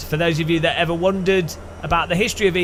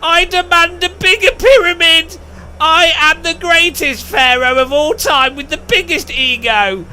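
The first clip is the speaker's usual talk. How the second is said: much higher and louder, grand slow boasting; arrogant pharaoh; 12 semitones higher, 7 dB louder than usual. Retold or imitated. Pharaoh boasting to his people about his ego, pompous and loud. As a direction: bellow like a vain god-king. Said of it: "the next pharaoh was like".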